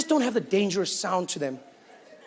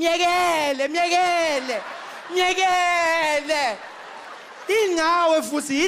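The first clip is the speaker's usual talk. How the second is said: township woman stopping a fight; 6 semitones higher, slower than usual. Retold or imitated. Township woman calling her drunk man away from a fight.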